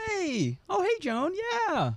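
sudden high, sugary phone greeting; sweet phone voice. Retold or imitated. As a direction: switch to a polite phone voice. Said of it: "like your mom's, like, arguing at you".

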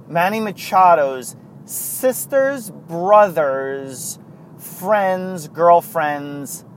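each name stretched out slowly for comic effect; slowing right down to milk a silly chain; drawn-out list. Pretend. Drawing out a ridiculous chain of friends for listeners, slowly and teasingly. Joking about an absurd chain of connections.

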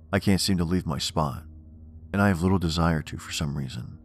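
usual speaking voice

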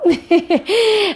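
quick amused laugh at herself. Real, not acted. Doctor laughs at her own silly song memory. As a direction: let a little laugh slip out.

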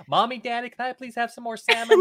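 lightly voiced pleading little kid; a hint of a begging child. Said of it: "you went to the accent for that, like, kid, kid voice thing".

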